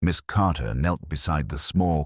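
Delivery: ordinary delivery, nothing marked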